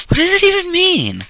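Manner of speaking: squeaky high mock voice; high-pitched. Very high put-on voice.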